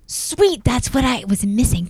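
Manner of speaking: high-pitched, pleased reaction; real delight. Real, not acted. Friend offers nail gun and saw, real delight. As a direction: start very high with delight, then ease down.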